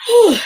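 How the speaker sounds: loud breathy exhale after effort; tired whew. Real, not acted. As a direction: blow out air, worn out. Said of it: "that just wore me out".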